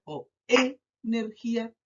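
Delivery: "energía" mis-stressed as É-nergia, slowly; stress on first syllable. Shown as a demonstration. Teacher testing a wrong option, "energía" stressed on first É. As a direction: hit a long É at the start of "energía". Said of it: "identificar dónde se carga la voz".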